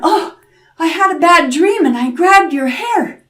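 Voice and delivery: high, fluttery apologetic voice; grandmother's excuse. A narrator's reading. Grandmother excusing herself to the devil, innocently. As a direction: plead innocence sweetly.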